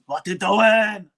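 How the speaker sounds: very bad action-hero impression, loud and slow; Arnie. Film fan quoting the Blu-ray sleeve, bad Arnie impression. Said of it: "that's my very bad Arnie impression".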